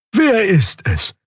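high, slow character voice; Xea asking. A narrator's reading. Character asking about the customer, slow and high. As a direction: stretch the question out, high-pitched. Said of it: "fragte Xea".

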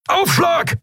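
very high, strained yell; urgent shout, pitch way up; roared warning. A narrator's reading. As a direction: bellow a warning before impact. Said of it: "brüllte Keru".

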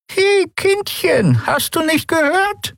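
high, slow, croaking crone voice; croaking old woman. Acted. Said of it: "krächzte die alte Frau".

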